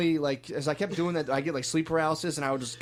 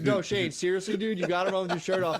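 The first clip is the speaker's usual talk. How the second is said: higher-pitched earnest insistence; mock pleading; 5 semitones higher than usual. Dreamer defending his shirtless run to a teasing friend, mock pleading. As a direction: plead your case, pitched up.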